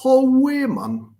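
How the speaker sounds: high, loud Geordie greeting; chirpy Newcastle accent. Retold or imitated. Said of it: "That's a really good Geordie accent".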